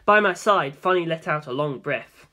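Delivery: plain reading voice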